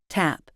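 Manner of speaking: "tap" with the final p held, not released; unreleased P. Shown as a demonstration. Teacher contrasts tap with tab, "tap" with unreleased p. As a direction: close the lips on the end of "tap" without a puff. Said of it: "The first one isn't released".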